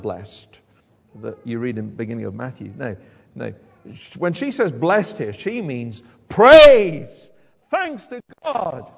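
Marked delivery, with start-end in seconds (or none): 6.3-8.9 slow, loud shout of praise; erupting praise. Acted. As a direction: burst out with thanksgiving. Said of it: "So Elizabeth erupts".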